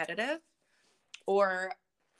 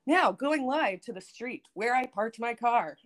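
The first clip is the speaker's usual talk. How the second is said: slow, higher reporter voice; going live like a field reporter; newscaster voice; 5 semitones higher, slower than usual. Shown as a demonstration. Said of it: "do a newscaster voice".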